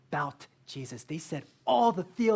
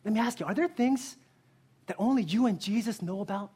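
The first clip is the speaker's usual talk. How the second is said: ordinary talk, nothing marked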